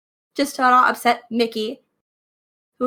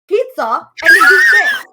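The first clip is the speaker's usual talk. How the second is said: indignant yell; 5 semitones higher, 9 dB louder than usual. Acted.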